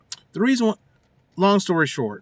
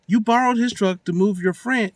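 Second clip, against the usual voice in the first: ordinary talk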